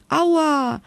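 small hurt yelp, up high; mild high ouch from the fairy; high ouch cry. A narrator's reading. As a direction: squeal a high little ouch. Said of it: "schrie die Fee".

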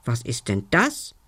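a puzzled goblin wondering aloud. A narrator's reading. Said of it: "fragte der Kobold".

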